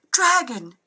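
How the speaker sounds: high, loud panicked scream. A narrator's reading. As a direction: shriek the alarm. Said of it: "I heard the servant screaming".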